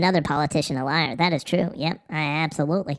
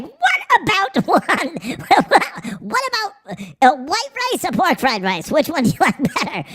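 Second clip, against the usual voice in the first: mock-giddy high voice; 10 semitones higher than usual. Streamer imitating a guy asking about rice, high and giggly. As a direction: squeal the menu questions like an excited kid. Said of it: "He was like".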